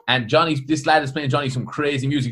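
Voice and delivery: ordinary talk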